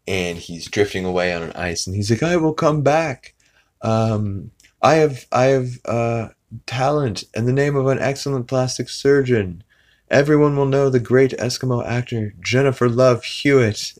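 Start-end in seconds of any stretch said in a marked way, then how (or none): 2.2-13.9 imitating Eskimo kid.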